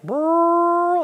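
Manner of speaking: high buzzing brrr imitating a vacuum; vacuum noise. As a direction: buzz like a running vacuum. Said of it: "like that".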